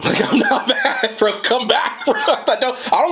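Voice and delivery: laughing it off, voice jumping high. Real, not acted. Laughing at an angry commenter, insisting he's not mad. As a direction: giggle through a denial you don't need to make.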